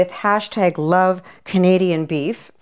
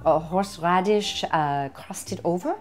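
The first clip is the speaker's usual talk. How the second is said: playful put-on French accent. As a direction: present the dish like a mock French host. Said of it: "I don't know why I'm talking French".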